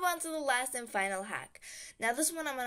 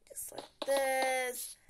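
hushed building aside; whisper; 3 semitones higher, much slower than usual. Real, not acted. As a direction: breathe the words out to yourself.

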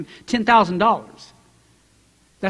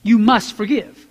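You must forgive, slow and louder; slow emphatic command; 3 dB louder than usual. Shown as a demonstration. Preacher to congregation, slow emphatic command. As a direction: spell the command out slowly. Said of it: "that word must".

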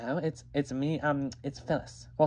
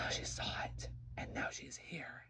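whispered complaint; 16 dB quieter than usual. Acted. Telling about the unwanted sister, whispered. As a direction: hush it like a secret.

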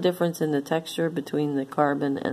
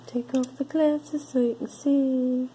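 silly put-on voice; 9 semitones higher than usual. Reading the tag in a silly voice.

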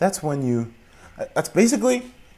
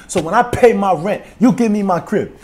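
higher, louder, put-on voice; mock street-tough lecture; 9 semitones higher, 6 dB louder than usual. Squatter lecturing villagers about rent.

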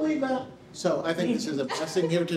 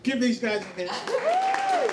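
high, slow call to the crowd; calling for applause; 11 semitones higher, much slower than usual. Real, not acted. Host rallies the room to applaud the performers, calling out slowly. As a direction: draw out the call for a hand.

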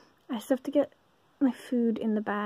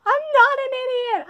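much higher and louder, mock offended; indignant; 14 semitones higher, 7 dB louder than usual. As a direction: squeal it like a wounded pride. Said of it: "I was getting a little like".

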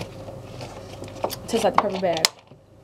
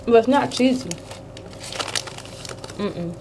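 talking while eating snack; speaking with food in mouth; mouth full, chewing; 4 dB quieter than usual. Real, not acted. Seen 0: Hand brings snack to mouth, then she speaks while visibly chewing.

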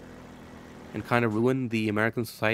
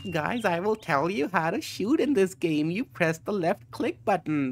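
higher, pompous mock-tutorial voice; mocking guide writer; 6 semitones higher than usual. Mocking a Steam guide author for chat with a pompous mock voice. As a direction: parody a smug know-it-all explaining the obvious.

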